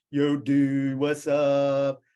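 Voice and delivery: imitating a chill California surfer coach; loud, easygoing "yo dude" surfer greeting. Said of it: "Like that. That's the way he talked".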